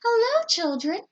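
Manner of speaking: high, sweet greeting. A narrator's reading. Kind teacher returns to her class, sweet greeting. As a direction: greet them kindly and sweetly. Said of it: "in a sweet voice".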